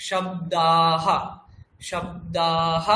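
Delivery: শব্দাহ low, with a long দা and a short হ; sounded visarga. Shown as a demonstration. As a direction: hold দা in শব্দাহ, then breathe out হ. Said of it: "বিসর্গের উচ্চারণ আমরা এখানে কিন্তু করব". (টেনে শব্দাহ, শেষে বিসর্গের হ)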